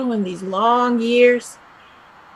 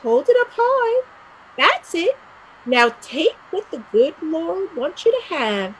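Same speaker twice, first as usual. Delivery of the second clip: stern nun; 8 semitones higher, 6 dB louder than usual. Acted. Mare acts out a nun punishing.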